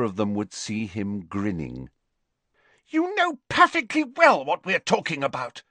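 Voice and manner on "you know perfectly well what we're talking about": high, indignant accusation; shrill. A narrator's reading. Girl accusing her friend in the changing room, shrilly. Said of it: "said Hermione shrilly".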